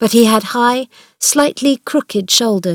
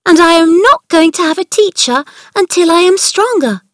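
high, loud joyful outburst; excited cry; 10 semitones higher, 6 dB louder than usual. Acted. Mary bursting in to tell Martha, excited cry. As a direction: burst out with joy.

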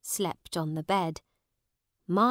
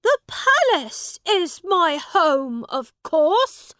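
put-on high, loud, slow young prince; the boy king insisting on his palace; lofty child royal outburst; indignant shout; 11 semitones higher, 9 dB louder than usual. A narrator's reading. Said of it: "shouted Edward".

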